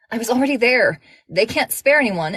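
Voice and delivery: urgent, pressing. Acted.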